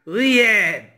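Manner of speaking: ordinary talk, nothing marked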